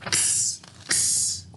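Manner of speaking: X as a soft unvoiced ks hiss; whispered letter sound. Shown as a demonstration. Teacher sounds out X in expert. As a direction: breathe the ks sound quietly. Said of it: "And the next sound?".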